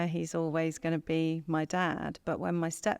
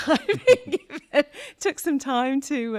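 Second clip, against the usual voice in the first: laughing; 9 semitones higher, 8 dB louder than usual. Real, not acted. Laughs while recalling advice.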